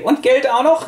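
cheeky begging. Retold or imitated. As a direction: push your luck, voice way up. Schnorrerin will noch Geld. (frech hoch gebettelt)

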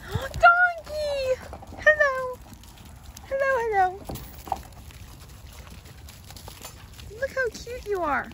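high cooing pet voice. Real, not acted. Cooing at farm animals.

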